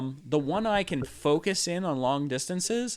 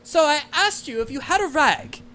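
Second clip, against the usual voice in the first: much higher, louder irritated repeat; impatient mother; 12 semitones higher, 5 dB louder than usual. Acted. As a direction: mum losing patience with the stranger.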